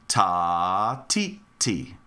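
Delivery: TA held long, each TI short; rhythm syllables. Shown as a demonstration. Teacher modelling a rhythm, ta drawn out, tis short. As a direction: chant a long "ta", then two brief "ti"s. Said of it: "Try it with me".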